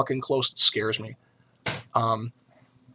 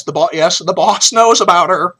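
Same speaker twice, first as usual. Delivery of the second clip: louder, laughing through the words; laughing aside; 6 semitones higher, 13 dB louder than usual. Real, not acted. Vlogger laughing as he tells viewers his wife knows. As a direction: chuckle it out as a cheeky reveal. Joking about wife knowing girlfriend.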